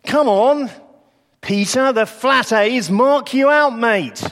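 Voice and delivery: high, loud jeering Glaswegian pub accusation; accusing northern voice. Acted. Bystanders in the courtyard accusing Peter, loud high jeering accent. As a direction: jab at him, loud and high, mock-accusing. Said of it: "that's what they say to Peter".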